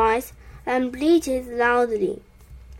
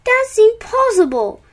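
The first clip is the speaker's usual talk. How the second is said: high, loud impatient retort; 9 semitones higher, 5 dB louder than usual. A narrator's reading. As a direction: dismiss it with raised, impatient voice. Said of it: "answered Farmer impatiently".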